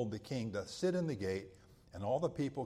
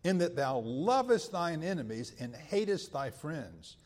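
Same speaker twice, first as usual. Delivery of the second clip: rising to a forceful rebuke, louder and higher; scripture reading, preaching force; 3 semitones higher, 5 dB louder than usual. Acted. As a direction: read Joab's rebuke with mounting force. Reads Joab's rebuke to David.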